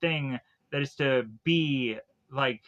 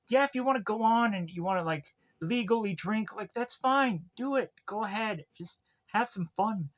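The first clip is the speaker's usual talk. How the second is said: voiced permissive Elaine; 5 semitones higher than usual. Voices Elaine giving Taylor permission.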